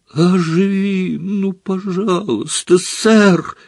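high, drawn-out begging; desperate pleading. A narrator's reading. Harry begging sir to come back, desperate pleading. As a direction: implore him in a high voice. Harry begs Dumbledore to revive.

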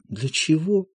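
soft, hushed grief; a quiet weeping lament; understated tearful mourning; quietly crying. A narrator's reading. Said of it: "Любовь Андреевна тихо плачет".

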